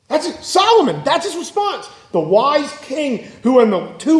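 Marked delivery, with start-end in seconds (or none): 0.1-1.9 much higher, disbelieving emphasis; incredulous. Real, not acted. Preacher marvelling to the congregation at Solomon, incredulous. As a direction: sound appalled at the wise king.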